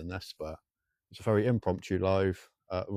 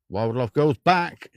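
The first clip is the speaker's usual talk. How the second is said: drawn-out announcement; 2 semitones higher, 7 dB louder, much slower than usual. Acted. Teasing viewer name, drawn out.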